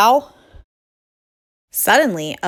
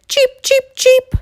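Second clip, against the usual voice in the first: very high, loud little cheeps; shouting chicks; 7 dB louder than usual. Acted. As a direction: cheep shrilly like excited chicks.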